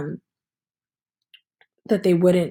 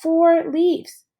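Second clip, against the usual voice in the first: mock counting; 7 semitones higher than usual. Boy counting leaves in story.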